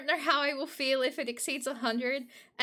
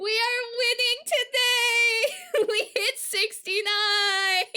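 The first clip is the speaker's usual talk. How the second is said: high, loud, slow crying cheer; triumphant cheer; 10 semitones higher, 6 dB louder, slower than usual. Real, not acted. Streamer cheering chat as the clip hits sixty-nine, high tearful whoops. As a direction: celebrate like a win after long waiting.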